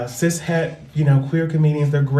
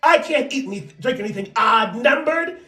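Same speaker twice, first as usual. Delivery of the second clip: hammed-up cartoon quarrel; 9 semitones higher than usual. Comic retelling a favourite SpongeBob scene, high cartoon outrage. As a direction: play it as an exasperated cartoon, pitched way up.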